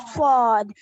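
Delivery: full, heavy "सौद" with rounded aw; heavy letter. Shown as a demonstration. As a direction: stress "सौद" loud, with a rounded vowel. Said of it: "heavy word".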